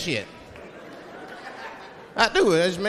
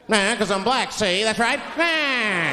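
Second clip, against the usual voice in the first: put-on higher, punchy old-time gangster voice; hammed-up gangster back-talk; forties bad guy; 5 semitones higher, 3 dB louder, much slower than usual. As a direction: talk crazy like a movie crook. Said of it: "I'll talk crazy".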